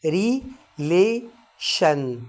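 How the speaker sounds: relation split slowly into syllables; relation, each syllable drawn out very slowly. Shown as a demonstration. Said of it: "هاخدها على كذا مقطع". (نطق relation ببطء مقطعًا مقطعًا)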